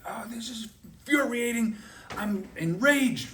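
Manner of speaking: high, hammed-up infuriated partner, slowing down; mock outrage. Business partner ranting in a negotiation, mock outraged. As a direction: play the furious partner, over the top. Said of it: "he's using language like".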